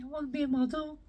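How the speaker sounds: high, far mock Aveline voice; Aveline impression. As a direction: play the vain Scouse girl dreaming aloud. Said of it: "Aveline wanted to be a model".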